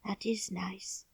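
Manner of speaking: sleepy whisper; half-asleep whisper; drowsy. A narrator's reading. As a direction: drift off as you breathe it. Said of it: "he said more drowsily still".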